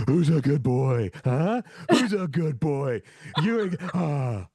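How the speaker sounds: put-on doting good-boy talk, drawn out; slow, playful dog-praise coo; Dexy the dog. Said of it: "look at me right in the eyes and go".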